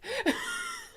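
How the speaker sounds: high-pitched burst of laughter. Real, not acted. Player reacting to the bizarre scene, laughing. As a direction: crack up high.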